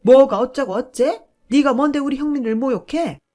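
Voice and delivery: mother-in-law enraged at her son being insulted, yelling; enraged shriek. Retold or imitated. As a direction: explode at the daughter-in-law.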